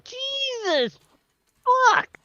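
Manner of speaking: startled curse. Real, not acted. Sees train status.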